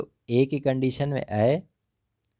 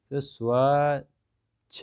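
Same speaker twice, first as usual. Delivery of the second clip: slow sounding out; 2 semitones higher, much slower than usual. Shown as a demonstration. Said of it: "तो क्या बन गया".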